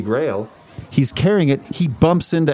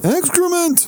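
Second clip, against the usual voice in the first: high, drawn-out Seinfeld word; mock Seinfeld punchline; slower than usual. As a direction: land it like Seinfeld. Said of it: "He would say".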